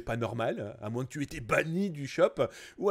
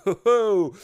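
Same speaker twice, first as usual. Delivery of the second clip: laughing; 3 semitones higher, 9 dB louder than usual. Real, not acted. Amused by hearts and teddies.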